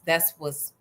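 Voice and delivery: ordinary talk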